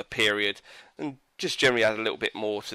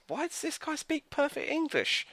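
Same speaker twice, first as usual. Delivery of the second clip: much higher and softer, baffled; incredulous; 6 dB quieter than usual. Real, not acted. Reviewer baffled by the English-speaking hero, incredulous and much higher. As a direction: ask it in total disbelief.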